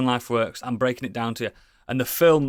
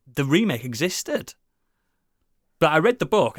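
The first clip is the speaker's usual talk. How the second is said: normal talking voice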